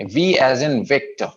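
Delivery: slow Victor with long VIC and short tor; long first syllable. Shown as a demonstration. Teacher models Victor after explaining, first syllable lengthened. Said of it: "टर को छोटा करेंगे".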